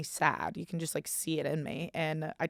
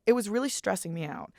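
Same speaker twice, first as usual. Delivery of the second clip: usual speaking voice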